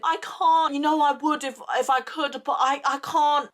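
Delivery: high, distressed plea; retold desperate begging, pitched high. Pleading with a friend who demands a favour, desperate begging. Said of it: "I was, like, begging him".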